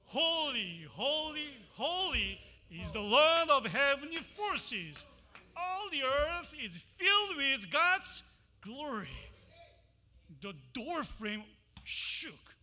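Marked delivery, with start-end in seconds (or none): 0.1-9.3 loud, ringing call of holy holy holy; shouted praise. Acted. Winged creatures calling to each other, loud shouted praise. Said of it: "They shouted to each other".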